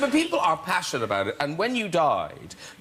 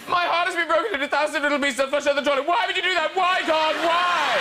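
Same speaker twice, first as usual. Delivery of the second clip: high, melodramatic mock despair; hammed-up heartbreak; heartbroken fan; 9 semitones higher than usual. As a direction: build to an anguished, high "why". Reads out a heartbroken fan's tweet.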